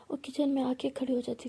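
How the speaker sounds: ordinary delivery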